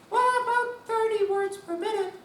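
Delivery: squeaky high meek applicant; high sing-song. Retold or imitated. Applicant answers in sung voice.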